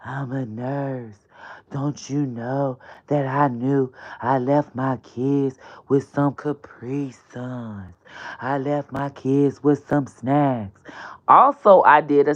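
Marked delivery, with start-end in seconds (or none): 0.0-10.8 soft, low, slow put-on voice; acting like Brittany. Host voices Brittany excusing leaving her kids, softly. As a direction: plead like her, quiet and slow. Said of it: "I was like".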